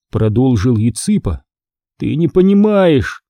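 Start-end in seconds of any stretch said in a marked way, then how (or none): 2.0-3.3 higher, drawn-out exclamation; crying out. A narrator's reading. Greg protests to his friends, crying out. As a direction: cry out in mild exasperation, slowly. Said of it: "вскричал Грег".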